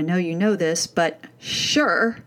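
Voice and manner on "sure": imitated dismissive teen "sure"; sarcastic brush-off. Mom quoting her daughter's answer about chores, sarcastic brush-off. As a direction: draw out SURE to brush someone off. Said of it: "It was a sarcastic brush off".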